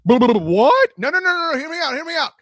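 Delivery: emphatic. Real, not acted. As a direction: wave off the objection. Heading off an expected objection.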